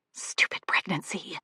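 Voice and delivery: plain reading voice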